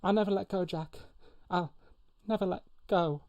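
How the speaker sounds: high-pitched halting plea; movie quote impersonation. Acted. Host redoing the film farewell to Jack, high halting plea. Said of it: "I'll do, I'll do it again".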